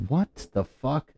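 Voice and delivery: normal talking voice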